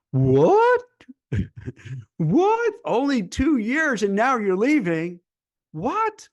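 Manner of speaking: shrieking high, loud, incredulous "What?"; outraged hiring manager. Retold or imitated. Recruiter imitating a hiring manager shocked by a short job, shrieking high. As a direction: squawk in disbelief at a job hopper. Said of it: "the hiring manager would be like".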